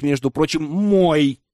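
plain reading voice

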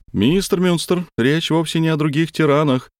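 high, excited machine voice; a buzzing eager analyst; eager robot doctor. A narrator's reading. Dr Jones excited.

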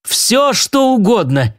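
lightly voiced overjoyed yell; delighted shout. A narrator's reading. Milt hearing Naomi say yes, delighted mild yell. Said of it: "восторженно заорал Милт".

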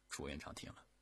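usual speaking voice

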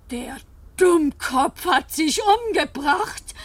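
bitter disbelief. Acted. Bitter reaction to suicide.

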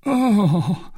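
high, anguished cry; dying man's cry. A narrator's reading. Poisoned soldier on seeing his supposed killer, agonised. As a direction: cry out in agony. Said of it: "cried he".

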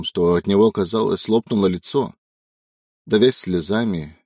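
ordinary delivery, nothing marked

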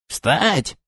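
high snake-tongued screech; shrieking lizard overseer. A narrator's reading. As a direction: bark it like a hissing reptile guard. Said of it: "пришёл визг змееязыкого надзирателя".

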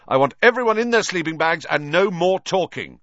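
high, loud prefect's call; shouting. A narrator's reading. Percy announcing lights out to the hall, shouting. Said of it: "Percy shouted".

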